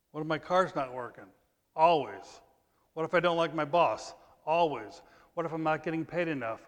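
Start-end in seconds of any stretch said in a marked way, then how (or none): none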